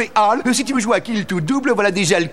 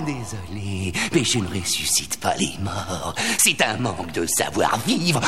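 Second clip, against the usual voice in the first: low solemn voice; 5 dB quieter than usual. Acted. Genie mock solemn about the dead.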